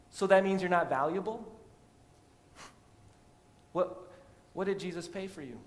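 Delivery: high, loud rhetorical retort; fervent preaching. Acted. Pastor rebutting a listener's self-doubt, loud preaching retort. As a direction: fire it back at the doubt.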